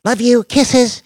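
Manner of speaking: high loud sweet farewell; sweet goodbye. A narrator's reading. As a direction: blow kisses with the words.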